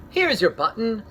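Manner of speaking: high eager call; Frog cries out. Acted. Frog calling to Toad about a found button, eager. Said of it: "cried Frog".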